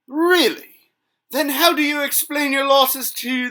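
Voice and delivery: high, loud mock disbelief; scoffing. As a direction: sneer the question. Rival scoffs at the knight.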